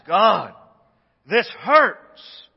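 loud, raw cry of hurt; pained plea. Pretend. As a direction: cry out to God in pain. Pleading prayer to God.

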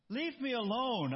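high, drawn-out storybook complaint; acted whiny rebuff; annoyed protest. A narrator's reading. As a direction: fend off the voice with slow exasperation.

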